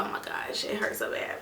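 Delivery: breathy whispered moan; whispered pain. Retold or imitated. Mother whispering her pain on the table. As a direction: whisper it like it's all you can manage.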